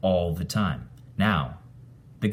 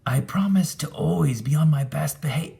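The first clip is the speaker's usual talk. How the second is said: high, earnest ghost cut off mid-word; a spirit swearing to behave; happy ghost promise; 9 semitones higher, 3 dB louder than usual. A narrator's reading. Ghost promises narrator, high and earnest. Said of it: "raised its arms to give me a hug and said".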